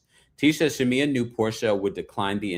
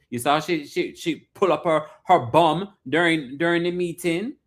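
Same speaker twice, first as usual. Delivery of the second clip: higher-pitched, imitated Caribbean accent; put-on Caribbean-style accent; 5 semitones higher than usual. Host mimics family refusing a business deal, put-on Caribbean accent. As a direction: act out a relative in a not-quite-Jamaican accent. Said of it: "I was trying to do the man's accent".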